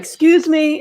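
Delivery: high, loud call for attention; flagging down help on the plane, loud and high. Retold or imitated. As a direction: call out to the cabin to get attention. Calling out to the cabin.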